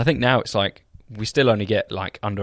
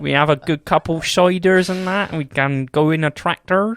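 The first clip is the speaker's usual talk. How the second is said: higher West Country burr; jolly Somerset impression; Somerset accent; 5 semitones higher than usual. Shown as a demonstration. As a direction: put on broad Somerset.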